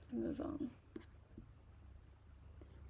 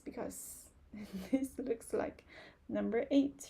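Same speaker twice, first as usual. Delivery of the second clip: usual speaking voice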